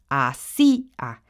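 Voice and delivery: Así a said slow, sí and a as two syllables; syllables split. Shown as a demonstration. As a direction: pull apart sí and a slowly. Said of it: "sí está haciendo las veces de otra". (hacía separado en sílabas, lento)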